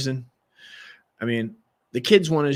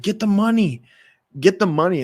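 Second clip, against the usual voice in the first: higher, louder frantic order; retold yelling; 7 semitones higher, 6 dB louder than usual. Mom ordering son during a fight, loud. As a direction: bark it out twice, urgent. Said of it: "yelling, and she's like".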